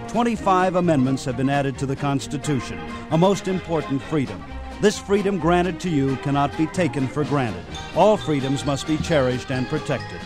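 usual speaking voice

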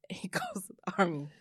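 breathy laugh-whisper, disbelief. Real, not acted. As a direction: giggle it out under your breath. Repeats it, laughing.

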